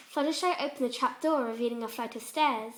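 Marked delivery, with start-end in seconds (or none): none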